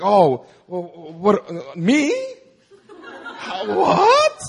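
stammering, flustered mock modesty, squeaking up high at the end; mock self-doubt. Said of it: "You have this attitude of like".

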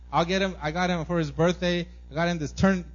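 ordinary delivery

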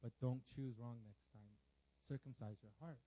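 low, soft, measured counsel; gently urge, pausing between sentences; subdued, serious instruction; God's quiet admonition. Acted. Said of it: "That's what he told me".

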